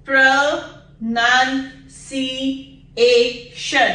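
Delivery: "pronunciation" stretched slow, syllable by syllable; very slow model. Shown as a demonstration. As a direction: draw the word out slowly. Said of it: "इनको पहले ध्यान से देखिए".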